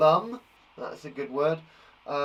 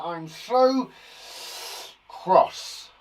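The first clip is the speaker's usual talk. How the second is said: higher, louder, hammed-up crossness; mock cross; 5 semitones higher, 5 dB louder, much slower than usual. Shown as a demonstration. Teacher testing synonyms for angry, mock cross. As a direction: play cross with a punched last word.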